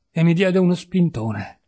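ordinary delivery

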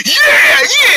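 very high, shouted yeah; battle cheer. Acted. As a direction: whoop to rally the gang. Gang cheers on the plan.

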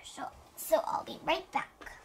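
soft unvoiced whisper; whispered aside. Doctor whispering while fetching the blood pressure cuff. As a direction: whisper it off to the side.

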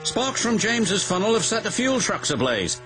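high-pitched urgent report; calling out. A narrator's reading. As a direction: call it down the line. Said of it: "he called".

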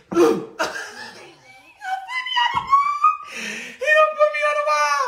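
very high squeal of disbelief; shrieking laugh. Real, not acted. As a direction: screech it like you can't believe it happened.

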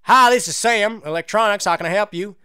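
lightly voiced retail employee; role-played store clerk. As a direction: answer the phone as an electronics clerk. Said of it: "that was a little role-playing there".

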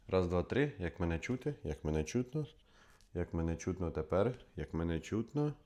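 normal talking voice

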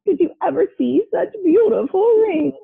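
high, self-admiring gush; vain. A narrator's reading. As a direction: show off with a little laugh first. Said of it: "Saturn was too vain".

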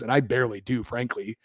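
ordinary talk, nothing marked

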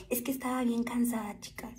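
soft unvoiced whisper; whispered confession. Real, not acted. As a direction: breathe it out tiredly. Sleepy confession.

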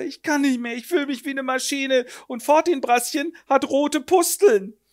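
high whining lament; a lightly voiced worn-out seamstress; mock despair. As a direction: moan in exhaustion. Exhausted mother laments.

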